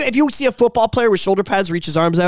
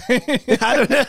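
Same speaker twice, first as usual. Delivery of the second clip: slow, high, laughing through it; 6 semitones higher, slower than usual. Real, not acted. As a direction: giggle the words out. Giggling over word plurals.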